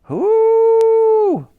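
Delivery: very high, loud trumpeting hoot; Wooly the mammoth trumpets, high and loud. A narrator's reading. Mammoth hoots again.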